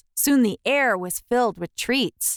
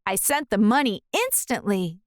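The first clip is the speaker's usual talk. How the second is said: ordinary delivery, nothing marked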